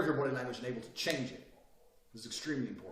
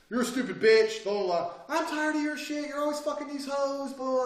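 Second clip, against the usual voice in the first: loud, high-pitched mock tirade; arguing girlfriend; 10 semitones higher, 6 dB louder than usual. Girlfriend accusing her man, arguing loudly. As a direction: imitate a fed-up girlfriend yelling. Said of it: "Let's say you're arguing with your girl".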